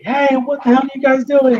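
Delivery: high-pitched imitation of a wife yelling; mock yell. Wife yelling down the alley at intruders, mock yell. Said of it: "my wife is yelling down the alley".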